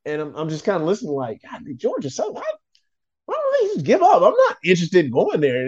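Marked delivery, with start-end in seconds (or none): 1.5-5.7 high, exasperated mock inner voice; incredulous dismissal. Recruit silently reacting to grown-ups' talk, high incredulous dismissal. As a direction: jump up high in mock disbelief.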